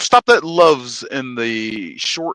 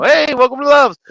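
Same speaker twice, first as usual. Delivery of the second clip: higher, louder cheery greeting; cheerful greeter; 11 semitones higher, 8 dB louder than usual. Retold or imitated. Trucker imitating Love's staff greeting families, higher and louder. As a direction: belt out a cheery welcome. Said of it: "Let's just say it".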